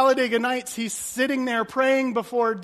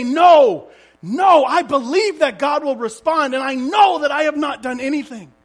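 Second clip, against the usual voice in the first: loud, pleading protest of innocence; defiant shout; 4 semitones higher, 10 dB louder than usual. Acted. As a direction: insist on it at full voice, refusing to give in. Job insisting against his friends.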